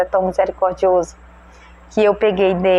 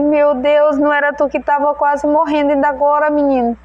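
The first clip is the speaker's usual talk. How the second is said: astonished mother; 6 semitones higher than usual. Retold or imitated. Mother stunned to find her sick son playing, raised amazed voice. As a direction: gasp it out to the boy, stunned.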